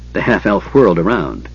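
usual speaking voice

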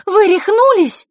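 high, loud, slowed cry; outraged cry. A narrator's reading. Girl confronting the boys who came in, outraged cry. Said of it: "первой выкрикнула Диана".

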